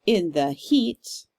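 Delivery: "In the heat," slow, landing on "heat"; stressed third syllable. Shown as a demonstration. Teacher scanning the line aloud, slow, stressing the last syllable. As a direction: tap out "In the heat," leaning on "heat". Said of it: "also on the third syllable".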